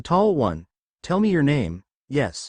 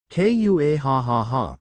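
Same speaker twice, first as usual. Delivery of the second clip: slow, hammed-up evil cackle; a drawn-out gloating mock-villain laugh; dragon's smug triumphant laugh; villainous laugh; much slower than usual.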